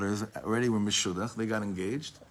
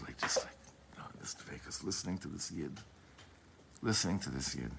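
hushed awe; 4 semitones lower, 10 dB quieter than usual. Retold or imitated. Rebbe in dveikus, listening quietly.

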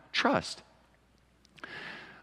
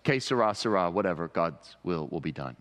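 flippant; 6 semitones lower than usual. Shown as a demonstration. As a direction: toss it off like it doesn't matter. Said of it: "a flippant sort of".

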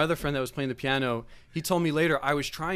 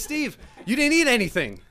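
high, loud mock fussing dad; mock scolding; 9 semitones higher, 8 dB louder than usual. Dad to guest who has eaten, mock scolding. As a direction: fuss loudly, pitched well up.

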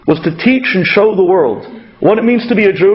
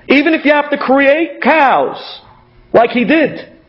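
preaching; 6 semitones higher, slower than usual. Acted. Preacher voicing Abraham's message.

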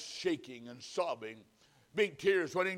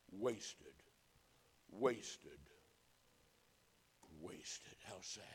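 low, very soft, grieving repetition; quiet sorrow with long pauses; hushed lament; 10 semitones lower, 16 dB quieter, much slower than usual. Real, not acted. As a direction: let each word sink in, fading to a whisper. Preacher laments a wasted life.

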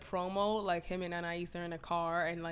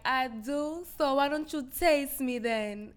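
higher-pitched imitation of a flirting singer; flirty mock reply; 5 semitones higher than usual. Reteller playing a singer flirting with her manager, raised mock voice. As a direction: lift the voice for a coy comeback.

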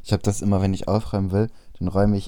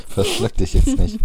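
laughing; 14 semitones higher, 5 dB louder than usual. Real, not acted. Laughing while friend chugs.

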